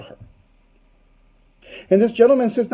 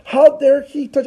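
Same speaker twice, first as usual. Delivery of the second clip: loud, raised-pitch acted outrage; furious; 5 semitones higher, 5 dB louder than usual. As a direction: burst out in indignant fury. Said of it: "she was very furious about this".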